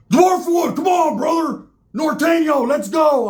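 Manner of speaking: rally your buddy into a fight; battle shout. Retold or imitated. Roach shouting battle cry.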